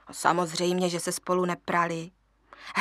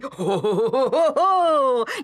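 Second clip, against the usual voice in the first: high rising cackle; hyena cackle; 4 semitones higher, 5 dB louder than usual. A narrator's reading. Hyena showing how to cackle, high cackle. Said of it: "se spolu rozchechtáme".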